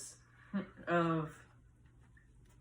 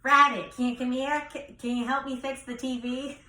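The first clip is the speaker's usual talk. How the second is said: Grandma Paulette; 3 semitones higher than usual. Acted. Grandson imitates great-grandma asking for help, New York accent. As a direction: call out in a New York accent, "Robert" as "Rabbit". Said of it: "a little bit of a New York accent".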